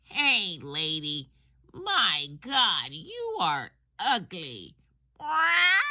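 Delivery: high squawky parrot taunting the lady. Acted. Parrot in the pet store window mocking a passing lady, high squawky voice. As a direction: squawk it out like a cheeky parrot, ending in a shrill What. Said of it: "once again it says".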